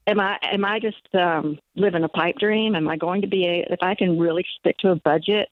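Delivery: ordinary delivery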